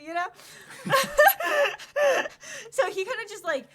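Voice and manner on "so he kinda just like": high, loud fit of laughter; laughing hard. Real, not acted. GM cracking up at the couple. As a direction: shriek with laughter.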